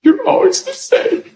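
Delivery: squeaky, very high mock complaint; high-pitched mimicry. Preacher acting out a family member's complaint, high-pitched mimicry.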